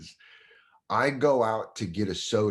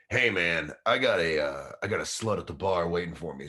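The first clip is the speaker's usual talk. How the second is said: sleazy club owner; 3 semitones lower than usual. Retold or imitated. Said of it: "that's completely how he talked".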